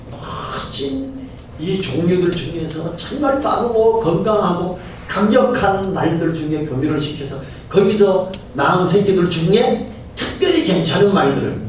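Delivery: normal talking voice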